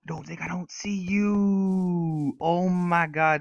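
high, slow, stretched-out call; drawn-out taunt. Real, not acted. Gamer to a player he spotted, drawn-out taunt. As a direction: draw every word out at the spotted player.

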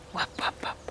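soft breathy wop wop to the ducks; whispered duck call. Real, not acted. Owner coaxing her ducks with a whispered call. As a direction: whisper the call to coax them.

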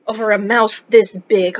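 ordinary talk

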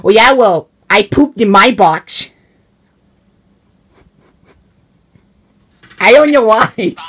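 high, loud boastful comeback; loud bragging. Acted. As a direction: top his brag by shouting it out. Box bragging contest escalates.

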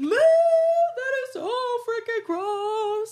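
high, slow wailing disgust. Real, not acted. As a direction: wail it out, grossed out. Disgust after gasp.

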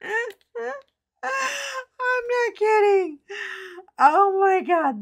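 high squeaky words squeezed out through real laughter; laughing till crying. Real, not acted. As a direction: let the laugh break the words apart, slow and high. Laughing after recalling donut note.

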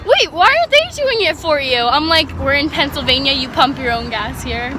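loud, high, excited road trip voice; loud excited chat. Real, not acted. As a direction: shout over the car noise, excited.

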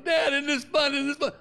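put-on high girlish squeal of delight; excited daughter. Daughter on the ride, high excited voice. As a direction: play the thrilled kid. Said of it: "Jamie's going".

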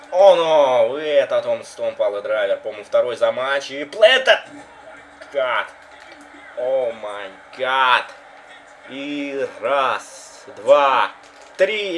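loud hype call with count; excited commentary. Real, not acted. (возбуждённый комментарий)

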